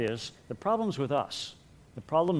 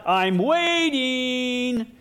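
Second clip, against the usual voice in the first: put-on very slow, high, loud kid's shout; shouting to God; 11 semitones higher, 7 dB louder, much slower than usual. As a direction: drag it out as a demand to the sky. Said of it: "And then shouting to God".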